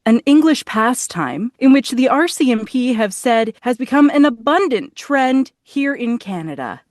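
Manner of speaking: polished national news anchor delivery; emphatic, measured broadcast newsreader; newscaster voice. Shown as a demonstration. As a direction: read it like the top story on national news. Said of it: "what's your best newscaster voice".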